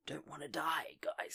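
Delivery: hushed aside; mock-fearful hushed aside; whisper. Real, not acted. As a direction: whisper it like someone might be listening. Fearful aside about KGB.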